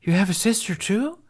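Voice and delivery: put-on high, loud surprised boy; a little boy amazed; surprised gasp. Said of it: "Jamie gasped".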